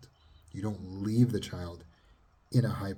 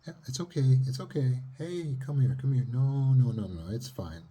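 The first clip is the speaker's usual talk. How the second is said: high, coaxing comfort; soothing mother; 6 semitones higher, 5 dB louder than usual. Retold or imitated. Mother soothing her daughter after the goose, high and coaxing. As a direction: gently hush a scared child. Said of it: "the mother says".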